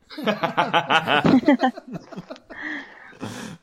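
big burst of laughter; loud laugh. Real, not acted. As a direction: crack up loudly at the name. Cracks up at gamer name.

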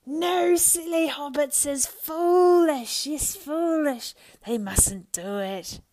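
high, loud, drawn-out creature wail; frightened, angry cry. A narrator's reading. Gollum protests at Sam's fire, crying out. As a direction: cry out in angry alarm, stretching the words. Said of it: "he cried".

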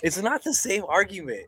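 high-pitched exasperated insistence; insist, voice climbing with frustration; getting mad. Real, not acted. Telling the co-host his argument is invalid, getting mad. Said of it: "I'm getting mad".

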